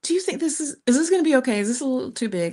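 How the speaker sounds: high-pitched nervous questions to the airline staff; anxious asking. Retold or imitated. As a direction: play her worried self asking about the bag, voice up high. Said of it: "but I was like".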